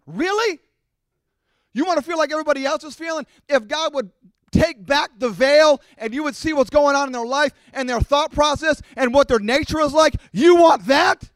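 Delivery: rising challenge, almost yelling; incredulous shout. Real, not acted. Preacher confronting the congregation's envy, building to a shout.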